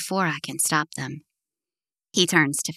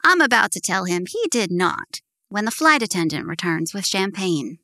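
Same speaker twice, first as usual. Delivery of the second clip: ordinary delivery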